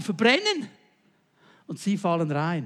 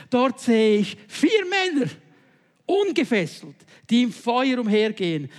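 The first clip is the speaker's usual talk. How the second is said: king calling out; 4 semitones higher, 6 dB louder than usual. Acted. King cries to his advisers about four men in the fire, loud and awed. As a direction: proclaim it in awe as the king. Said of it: "rief Nebukadnezar".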